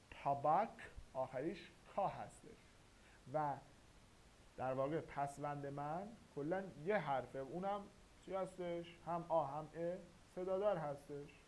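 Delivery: ordinary talk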